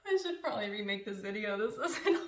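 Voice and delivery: giggling through the words; laughing. Real, not acted. To viewers after a fail, giggling. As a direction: laugh at your own mess.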